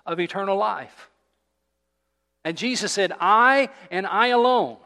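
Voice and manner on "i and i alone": slow, louder stress on "I" and "alone"; emphatic I alone. Shown as a demonstration. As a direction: hammer each "I" slowly and loudly. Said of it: "that word is emphatic".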